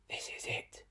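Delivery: soft whisper under the breath; whispered to himself. Acted. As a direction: whisper it to steady yourself. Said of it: "he whispered to himself".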